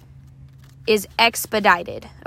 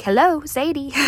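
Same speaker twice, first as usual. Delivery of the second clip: mentor prompting; 5 semitones higher than usual. Retold or imitated. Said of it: "She was like".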